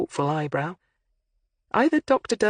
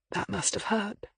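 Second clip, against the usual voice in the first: soft and gentle, much quieter; gently; 4 semitones higher, 7 dB quieter than usual. A narrator's reading. Detective gently probing the hurt academic, soft and gentle. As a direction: ease it out softly, with sympathy. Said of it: "and then said gently".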